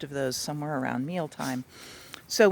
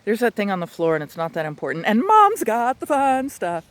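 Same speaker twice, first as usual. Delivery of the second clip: dog's thoughts; 5 semitones higher, 9 dB louder than usual. Retold or imitated. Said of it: "is to go".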